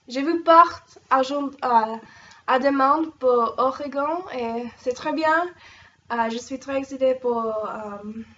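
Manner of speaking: halting beginner French; bad French. Shown as a demonstration. Vlogger greeting French host family in beginner French. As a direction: stumble through learner French proudly. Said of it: "that was French, really bad French".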